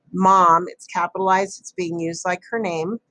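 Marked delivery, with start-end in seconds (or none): none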